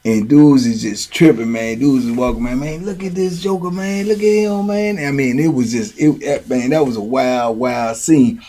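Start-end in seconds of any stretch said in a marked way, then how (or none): 2.6-5.0 softer aside in higher voice; imitated hushed remark. Onlookers on the yard watching the joker, hushed amazed aside. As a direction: pass it quietly to a buddy.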